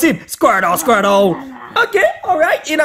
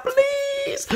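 squeaky plea; 10 dB quieter than usual. Real, not acted. Begging for a legendary.

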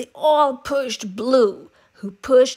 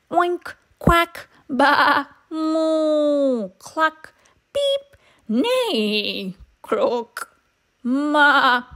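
animal noises; 4 semitones higher, much slower than usual. A narrator's reading. Animals sounding off together.

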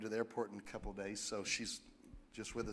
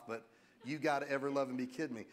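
ordinary talk, nothing marked

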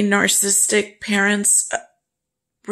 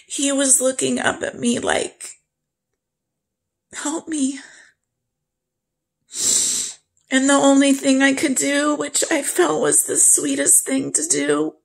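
higher, tearful voice; choked-up grief; 5 semitones higher than usual. Real, not acted. Owner recalling putting the dog down, choked up. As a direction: struggle through it, pitch lifted with grief.

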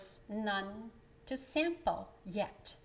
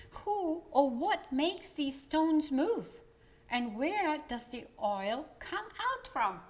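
put-on curious child; 6 semitones higher, 3 dB louder than usual. Child asking questions.

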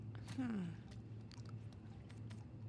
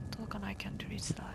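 whispering; 4 semitones lower, 10 dB quieter, much faster than usual. Real, not acted. Sneaking past a monster.

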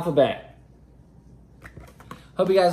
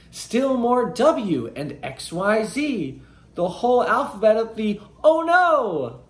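lively, higher picture-book read-aloud; reading to children; 5 semitones higher than usual. Acted. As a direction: read it like bedtime storytime for kids.

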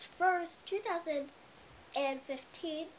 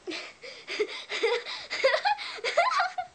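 a burst of high, loud giggles; high laughing fit; 4 dB louder than usual. Real, not acted. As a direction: laugh out helplessly. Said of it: "Sorry if I'm laughing".

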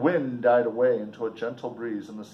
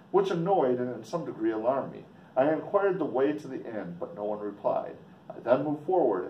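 usual speaking voice

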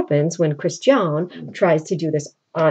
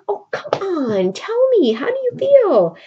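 high, wheedling plea to open up; coaxing, impatient; 12 semitones higher than usual. Retold or imitated. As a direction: coax the words out of him. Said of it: "She's like".

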